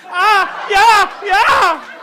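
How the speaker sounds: very loud, high, hammed-up moans; mock climax shout. Comic acting out the porn tape's climax, loud mock moaning.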